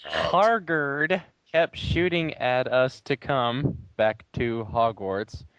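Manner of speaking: normal talking voice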